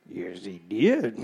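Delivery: very low, slow mock-villain voice; deep villain voice. Preacher joking to the congregation, mock deep villain voice. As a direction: answer in a drawn-out rumble from way down low.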